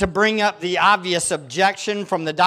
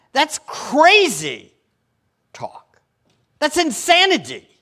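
emphatic outrage; 7 semitones higher, 4 dB louder, slower than usual. Real, not acted. Preacher decrying sin.

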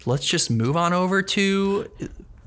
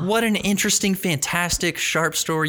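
mock excitement; 7 semitones higher, slower than usual. Mock cheer over embarrassing story.

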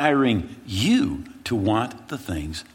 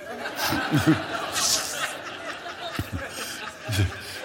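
breathy laugh; 5 semitones higher, 5 dB quieter than usual. Real, not acted. Laughing at own story.

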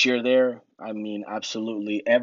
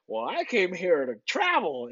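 slow, higher mocking voice of a coworker; mock travel guy; 7 semitones higher than usual. Imitating the sailor who joined to travel, slow and mocking. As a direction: ham up the sailor who only came to travel.

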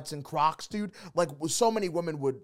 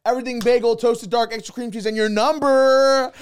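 higher, louder order, stretched at the end; bold customer; 6 semitones higher, 6 dB louder than usual. Acted. Friend modelling how to ask crush out, loud bold order. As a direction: belt out the pick-up line like an order. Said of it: "you gotta just be like".

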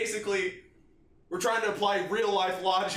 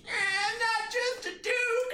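high, slow wailing mock outrage; mock enraged cry; 14 semitones higher, much slower than usual. Friend mocking Duke's blind rage, high drawn-out wail. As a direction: wail it like a hurt kid throwing a fit.

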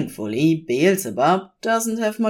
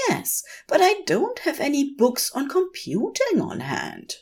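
a higher, fussier voice objecting; protesting; 11 semitones higher than usual. A narrator's reading. Aziraphale protests to Crowley, lightly voiced flustered objection. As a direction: push back like a flustered bookish angel. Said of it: "Aziraphale protests".

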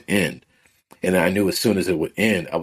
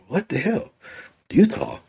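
higher, stunned what-the-hell; shocked disbelief; 11 semitones higher than usual. Retold or imitated. Telling listener how he reacted to Spirit, in shocked disbelief. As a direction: caught off guard by a strange order.

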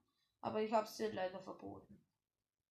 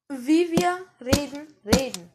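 showing the tone; 13 dB louder than usual. Shown as a demonstration. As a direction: show how we talk, loud.

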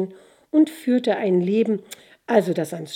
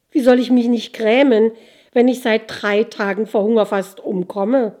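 loud aggrieved complaint; 2 semitones higher, 7 dB louder than usual. Acted. Starving soldier answers spirit.

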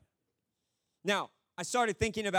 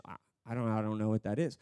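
much lower, halting reply; bewildered thief; 9 semitones lower, faster than usual. Acted. Thief stumped by the angel's doctrine question, bewildered. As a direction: mumble it, completely lost. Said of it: "the thief is just like".

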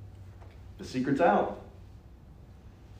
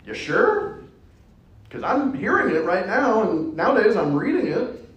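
high, mocking, laughing doubt; rising sarcastic questions, pitch climbing; smart-aleck disbelief; 8 semitones higher than usual. Smart aleck needling the gospel reader, sarcastic and high. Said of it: "The smart aleck in me".

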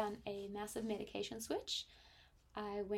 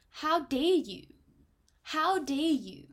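slow, loud outraged demand, repeated; 6 semitones higher, 9 dB louder, slower than usual. Retold or imitated. Telling of the farm burglaries, slow loud outraged challenge. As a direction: hurl the question at the burglars, each word heavy.

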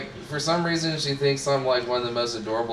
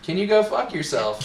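calm deadpan retort, mildly higher; deadpan insult; 7 semitones higher than usual. Retold or imitated. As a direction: toss it off nonchalantly. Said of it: "with the most nonchalant look".

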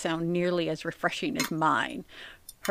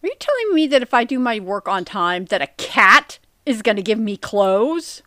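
louder, raised, disbelieving question; mock incredulous; 3 semitones higher, 6 dB louder than usual. Co-host questions host about the cat tale, mock incredulous. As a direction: challenge it, building to a loud "clothes".

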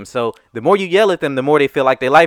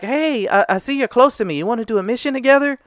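lightly voiced young boy, higher; a hint of a friendly ten-year-old asking to team up; kid's voice; 7 semitones higher, 4 dB quieter than usual. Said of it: "He sounded like he was about ten years old".

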